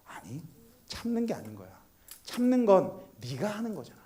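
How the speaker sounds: very soft fatherly explanation; gentle correction. Retold or imitated. Father teaching his son about patience, soft and gentle. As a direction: correct him gently, almost hushed.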